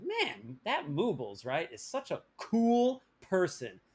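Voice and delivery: "cool" stretched and punched in admiration; stress on cool person. Shown as a demonstration. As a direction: lean hard on "cool person". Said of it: "Emphasis on the cool person".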